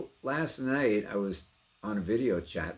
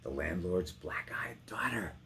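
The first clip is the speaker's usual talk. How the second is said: hushed aside. Acted. Actor reading Bess's description, hushed and secretive. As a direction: lean in and breathe it like a secret. Whispered repeat in poem.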